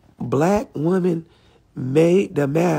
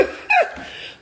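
high-pitched burst of laughter. Real, not acted. Laughing at own joke.

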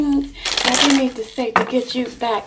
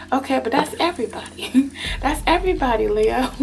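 ordinary talk

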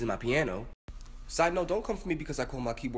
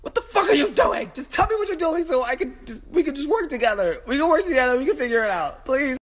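high, loud, near-crying wail; desperate squealed plea; frantic pleading; 13 semitones higher, 6 dB louder than usual. Real, not acted. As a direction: wail and beg it to cooperate. Begging the bug to cooperate.

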